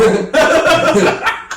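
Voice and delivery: high, loud burst of laughter. Real, not acted. Guest cracking up at the sign-off, laughing loudly. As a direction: burst out cackling.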